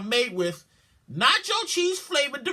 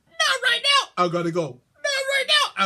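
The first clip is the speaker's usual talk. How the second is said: voice of the stomach; 9 semitones higher than usual. Acted. Stomach dialogue voice.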